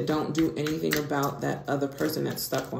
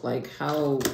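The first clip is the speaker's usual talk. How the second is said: usual speaking voice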